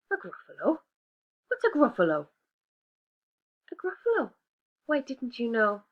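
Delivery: prim little mouse declining; polite little mouse. Acted. Mouse character voice.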